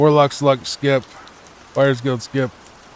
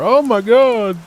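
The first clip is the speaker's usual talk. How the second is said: mock panic; 9 semitones higher, 5 dB louder, slower than usual. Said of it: "she was terrified of those things".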